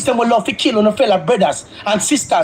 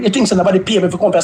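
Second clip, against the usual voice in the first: ordinary talk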